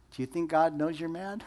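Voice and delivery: normal talking voice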